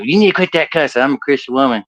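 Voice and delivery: retold grandma's reproach; scolding grandma. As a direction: scold lightly in an old-lady voice. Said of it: "She goes".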